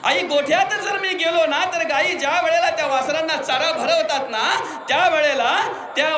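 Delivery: ordinary talk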